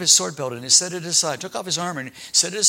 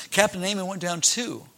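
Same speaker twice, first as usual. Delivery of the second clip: ordinary talk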